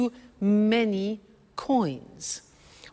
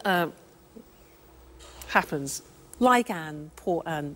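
ordinary talk

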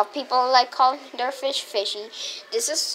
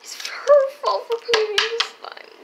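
put-on high whiny kid; 9 semitones higher than usual. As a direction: insist it, whining higher. Repeated louder.

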